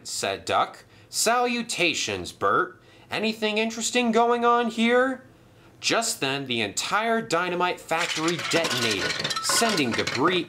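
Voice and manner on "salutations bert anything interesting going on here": high, bright greeting voice; a friendly engine greeting old friends; Bert, cheery engine. Acted. Bert greets Duck, high and cheery.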